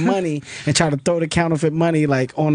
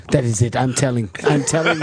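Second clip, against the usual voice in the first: low-pitched imitated African accent, fed up; firm African-accented mock threat; 6 semitones lower than usual. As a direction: imitate your African friend reaching his limit. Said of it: "he's got this African accent".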